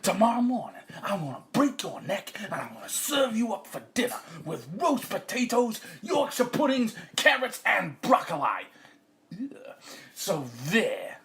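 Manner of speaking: loud, high hammed-up threat; angry farmer. Farmer threatens cockerel with dinner, loud rant. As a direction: rant with gleeful menace.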